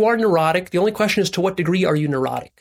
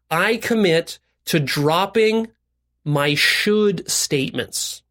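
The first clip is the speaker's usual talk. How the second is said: very slow, phrase-by-phrase pledge; deliberate recitation; much slower than usual. Shown as a demonstration. Coach modelling a commitment for listeners, deliberate recitation.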